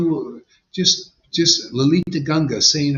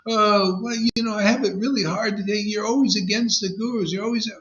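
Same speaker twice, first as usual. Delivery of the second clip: higher, quicker whiny student voice; mimicking a student; 8 semitones higher than usual. Teacher imitating a student who complains about him. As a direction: play the complaining student, pitched up. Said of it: "one of my students yesterday said".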